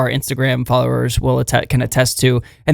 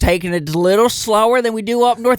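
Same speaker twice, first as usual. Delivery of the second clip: slightly raised, put-on Southern voice; Southern accent; 4 semitones higher than usual. Shown as a demonstration. Host showing co-host his Southern voice, Southern accent. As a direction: play a Southerner talking about Yankees. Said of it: "that's your Southern voice".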